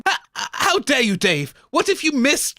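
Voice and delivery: high, indignant outburst; offended storyteller. Real, not acted. Narrator indignant at interruption.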